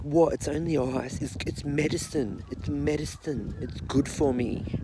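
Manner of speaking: high, hammed-up defensive protest; mock defensive girlfriend. Girlfriend defending her habit, in a mock indignant voice. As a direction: insist it's only medicine, a little indignant. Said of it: "she's like".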